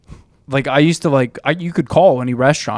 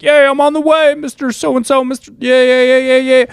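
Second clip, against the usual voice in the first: phone act; 11 semitones higher, 4 dB louder than usual. Retold or imitated. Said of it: "be like".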